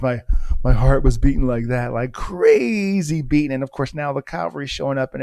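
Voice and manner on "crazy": "crazy" stretched long and pitched up; drawn-out CRAZY. Retold or imitated. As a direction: draw out the first syllable of "crazy". Stretches word to show racing heart.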